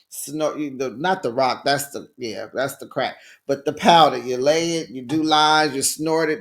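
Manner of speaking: normal talking voice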